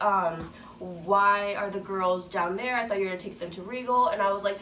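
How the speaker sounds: ordinary talk, nothing marked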